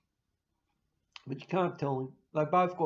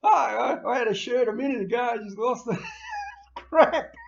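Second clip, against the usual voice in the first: higher, louder and fast, laughing at own ruin; laughing mock dismay; 7 semitones higher, 8 dB louder than usual. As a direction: blurt out the loss half laughing. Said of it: "And you're there going".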